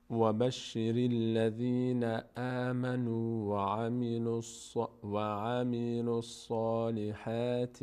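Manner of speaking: slow, level tajweed chant; measured verse-reading on an even tone; Quranic recitation. Shown as a demonstration. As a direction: recite with long held vowels. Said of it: "از اول بخونیم".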